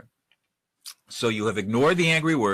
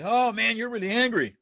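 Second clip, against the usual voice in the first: high, emphatic empathy without shouting; match their anger, held a notch below; intense but underneath; 11 semitones higher than usual. Shown as a demonstration. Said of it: "it'll be intense, but it will be underneath the intensity".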